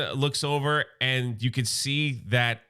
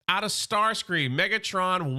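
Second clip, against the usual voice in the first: ordinary talk, nothing marked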